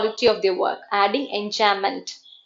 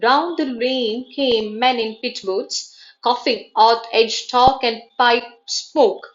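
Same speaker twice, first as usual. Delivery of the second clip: very slow, measured recitation with pauses; trochaic verse reading; 2 semitones higher, much slower than usual. Shown as a demonstration. Teacher reading a poem as a trochaic example, slow and emphatic. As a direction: stretch each beat, stressed then unstressed. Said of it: "trochaic-ിൽ വരുമ്പോൾ stressed followed by unstressed".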